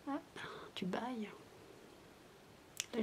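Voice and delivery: ordinary talk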